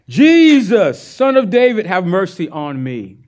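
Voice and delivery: high, loud plea called out; crying out. Retold or imitated. Blind man calls out to Jesus, pleading loudly. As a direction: cry out to Jesus for mercy. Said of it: "he began to cry out".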